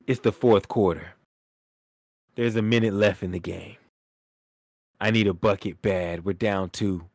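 low dramatic scene setting; scene-setting storytelling. Real, not acted. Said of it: "Let me put the scene out for you".

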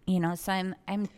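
normal talking voice

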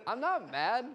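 acted protest, still fairly contained; mild protest. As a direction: imitate someone starting to get irked. First denial in acted teasing argument.

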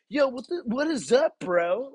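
very high, loud dude voice; hammed-up bro greeting, imitating the waiter; put-on excitable buddy; chill bro waiter. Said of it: "Like that".

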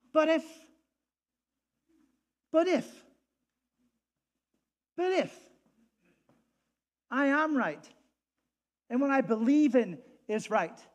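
high, loud, passionate pulpit delivery; a pastor at full cry, loud and fervent; full-force preaching. Acted. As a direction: preach it like the crowd must hear every word.

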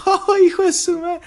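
very high mock reaction; amused Spanish exclamation. Showing classmates' reaction to a joke in Spanish, high exclamation. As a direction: squeal it as if bursting out laughing. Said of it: "or in Spanish".

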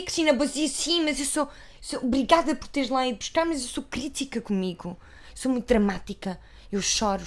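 hint of a self-pitying star; imitating Teresa. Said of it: "porque ela é".

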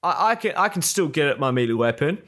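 a hint of the clueless teammate; lightly voiced excuse, brushed off; imitating teammate. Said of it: "He goes".

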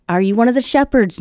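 mother's question in a lifted voice; voiced mother. Voicing mother guessing the part.

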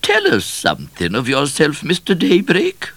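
high, loud royal command; commanding queen. A narrator's reading. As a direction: order it in a high, regal voice. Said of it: "commanded the Queen".

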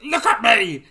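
a hammed-up teenage yell, loud; mock angry shout. Imitating angry Harry shouting at everyone. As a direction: yell it like moody teen Harry. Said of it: "where he's a bit".

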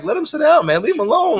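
put-on high-pitched plea to let the old wrestler sit; mock pleading. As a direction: beg high for them to leave him be. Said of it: "I'm like".

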